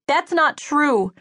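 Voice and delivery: high, drawn-out protest. A narrator's reading. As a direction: protest it slowly with rising pitch. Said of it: "I protested".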